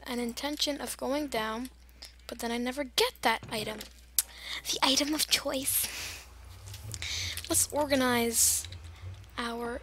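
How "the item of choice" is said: dramatic whispered aside; mock-mysterious whisper. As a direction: whisper it like a secret.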